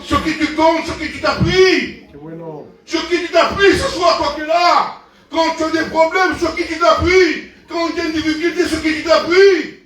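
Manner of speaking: preaching. Acted. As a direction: drive the question home to the congregation. Preacher presses the congregation.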